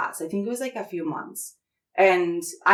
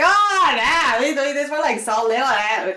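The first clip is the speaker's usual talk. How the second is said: imitating Elvia; 7 semitones higher, 5 dB louder than usual. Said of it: "And she's like".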